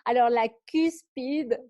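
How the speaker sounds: deliberate, emphatic "cuspide"; slow, careful enunciation. Real, not acted. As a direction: spell out "cuspide" slowly so it is understood. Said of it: "quand je parle vite".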